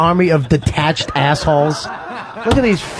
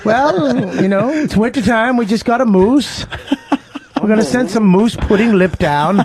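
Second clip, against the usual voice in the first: mock Canadian accent; 4 semitones higher than usual. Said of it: "your shitty accent".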